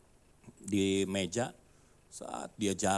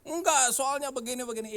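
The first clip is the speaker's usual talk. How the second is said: foreigner protesting. Retold or imitated. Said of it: "Dia bilang gitu".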